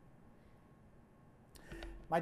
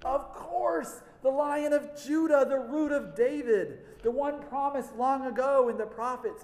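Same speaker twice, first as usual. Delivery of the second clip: higher, louder burst of sudden hope; excited realization; 8 semitones higher, 5 dB louder than usual. Acted. Preacher voicing relief at news of the Lion, excited. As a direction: proclaim it high and strong, like good news breaking.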